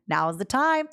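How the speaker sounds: normal talking voice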